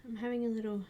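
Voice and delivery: usual speaking voice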